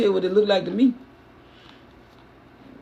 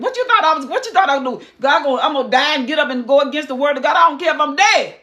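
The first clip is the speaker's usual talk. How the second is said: scolding Samuel; 6 semitones higher, 4 dB louder than usual. Acted.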